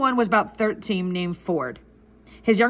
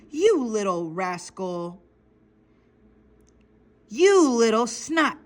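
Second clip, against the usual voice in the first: slow, menacing scolding; drawn-out threat, temper held in; mild angry growl; slower than usual. Acted. Angry guard to the boy who yelled at him, slow scolding. Guards angered by the boy's yell.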